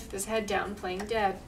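plain reading voice

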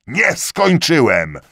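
loud, high, slow interruption; cutting him off. A narrator's reading. Lieutenant interrupting the corporal, loud and high. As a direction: cut him off sharply. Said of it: "uciął inspekcyjny".

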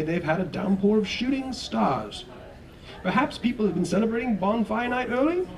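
high, breezy TV weatherman; cheerful forecaster joking with the viewers. Acted. Weatherman answering Ted on air, high and jolly. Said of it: "said the weatherman".

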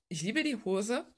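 ordinary talk, nothing marked

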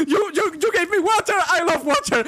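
high shrieking laughter through the words; laughing shriek. Real, not acted. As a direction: lose it completely, squealing.

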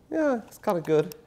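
lightly voiced bored kid; a hint of a reluctant child; kids' half-hearted verdict. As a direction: shrug it off like an unimpressed teenager. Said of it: "And they go".

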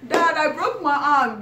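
high, loud, laughing plea; mock pleading kid. Kid tells dad about a broken arm, high laughing mock plea.

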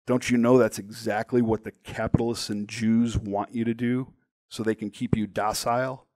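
usual speaking voice

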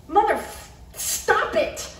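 high startled yell; yelp. A narrator's reading. Garrett yelling as water hits his face, shocked. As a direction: cry out in shock.

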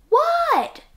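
high loud called-back What?; 1 answering call. A narrator's reading. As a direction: shout back across the house.

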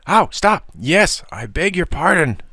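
high-pitched yelp into hasty apology; squealing surrender; pained yelping. A narrator's reading. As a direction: give in while being shaken. Shaken bully yelping, giving in.

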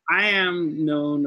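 ordinary talk, nothing marked

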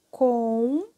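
"comprar" broken by a long pause after com; sílabas separadas. Shown as a demonstration. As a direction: hold com, wait, then say prar in "comprar". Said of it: "Quando eu separo". ("comprar" dividido com pausa longa: com... prar)

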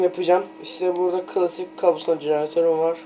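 usual speaking voice

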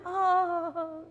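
high, loud, long "oh"; relieved sigh. Acted. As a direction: let out a big blissful oh. Man relishes the quiet at last.

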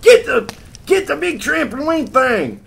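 high, very loud holler; urgent calling out. Retold or imitated. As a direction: shout down to the firemen below. Acts out calling to firemen below.

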